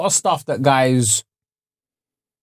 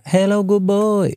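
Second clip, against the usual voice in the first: slow, higher-pitched masseuse greeting; mock masseuse voice, slow and raised; Thai masseuse impression; 7 semitones higher, slower than usual. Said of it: "there's one woman that's always like".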